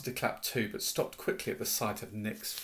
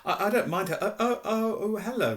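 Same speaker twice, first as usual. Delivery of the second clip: high, flustered stammer; frantic; 8 semitones higher, 4 dB louder than usual. A narrator's reading. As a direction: stammer a hasty, panicky cover-up. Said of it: "Hermione whispered frantically".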